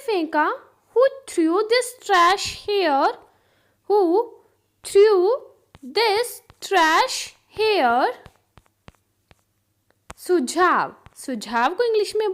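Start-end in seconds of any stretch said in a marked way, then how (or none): 3.9-8.1 loud, separated words with long pauses; word-by-word dictation. Shown as a demonstration. Teacher repeats the trash question for learners, word by word. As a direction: stop after nearly every word.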